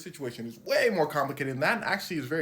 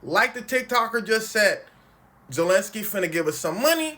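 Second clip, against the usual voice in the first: loud, raised imitation of Trump; mock Trump shouting; 6 semitones higher, 10 dB louder than usual. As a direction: bellow it like a brash boss. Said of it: "Donald Trump is saying".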